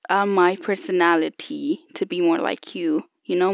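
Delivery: ordinary talk, nothing marked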